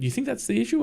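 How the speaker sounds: high, lightly voiced surprise; surprised friend. Friend doubting the printer is the cause, high and surprised.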